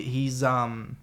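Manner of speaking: normal talking voice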